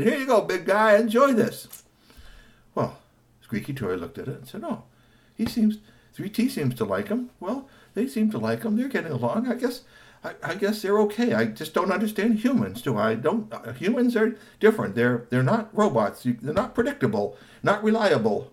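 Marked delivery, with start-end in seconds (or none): 0.0-1.8 high, cheerful handing over; friendly vendor. Acted. Vendor handing the chili dog, high cheerful. 4.6-18.5 high, puzzled thinking aloud; lightly voiced robot pondering humans; musing robot. Robot watching the kids, high musing.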